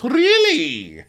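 very high, loud squeal of surprise; guy caught out about other girlfriends, squeaky high; mock surprise. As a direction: shoot up in pitch, fake shocked. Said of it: "He goes".

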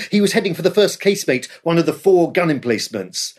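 ordinary delivery

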